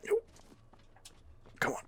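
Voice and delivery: normal talking voice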